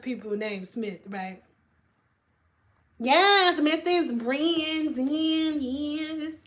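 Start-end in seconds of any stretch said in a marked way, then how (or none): 3.0-6.3 slow, stretched, higher-pitched greeting; playfully drawn-out name. Real, not acted. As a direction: start punchy, then stretch the name out long. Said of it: "I always say her name like that".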